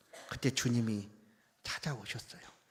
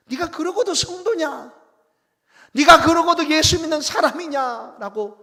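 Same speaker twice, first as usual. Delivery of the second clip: higher and much louder, yelling scolding questions; the Lord as he did not speak, blasting a disciple who failed; furious rebuke; 10 semitones higher, 15 dB louder than usual. Pretend. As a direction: shout down a failed disciple in outrage. Imagined rebuke the Lord never gave.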